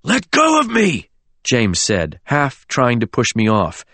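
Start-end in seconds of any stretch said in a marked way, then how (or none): 0.0-1.1 high, struggling cry; desperate demand. A narrator's reading. James pushes Oliver off, desperate demand. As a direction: demand to be released.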